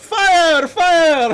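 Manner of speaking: high, loud cry of alarm; shouted alarm. Pretend. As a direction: yell it to clear a crowded room. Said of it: "I'm gonna shout".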